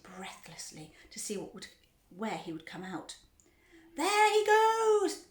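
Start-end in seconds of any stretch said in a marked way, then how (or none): 3.9-5.3 high, very drawn-out shout. A narrator's reading. Onlooker spotting the boy in the pipe, shouting. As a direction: call it out long and loud. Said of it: "somebody shouted".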